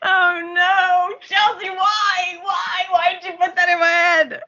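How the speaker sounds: high, laughing cry of protest; laughing mock anguish. Real, not acted. Laughing protest at friend Chelsea for the gross image. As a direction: wail at her friend through laughter, half pleading.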